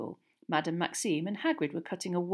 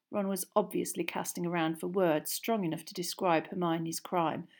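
plain reading voice

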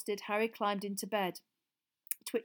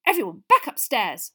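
bossy prefect; 3 semitones higher, 14 dB louder than usual. Acted. Percy orders everyone to bed.

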